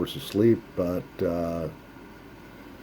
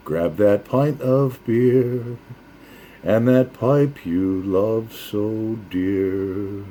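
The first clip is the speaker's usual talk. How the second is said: drawn-out toast, slow; slow rhyming toast; 2 semitones higher, much slower than usual. Acted. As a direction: raise a glass, slow and sung-like.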